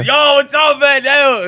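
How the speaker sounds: high, loud, excited hello; walking into the store shouting hello, excited; hyped greeting. Retold or imitated. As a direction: burst in yelling a cheerful hello. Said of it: "'Cause I walk in".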